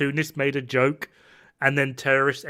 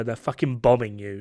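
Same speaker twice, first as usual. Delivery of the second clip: usual speaking voice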